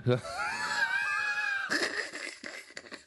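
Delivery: long high laugh. Real, not acted. Laughs after confessing he forgot.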